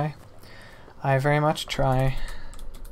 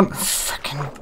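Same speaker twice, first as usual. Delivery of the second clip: soft unvoiced swear; defeated whispered oath; whispered curse; 10 dB quieter, much slower than usual. Real, not acted. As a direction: breathe the curse out under your breath. Quiet curse after losing.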